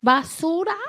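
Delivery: small rising question in Spanish; lightly voiced child asking; grandbaby's Spanish question. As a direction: ask like a curious toddler. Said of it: "he speaks Spanish".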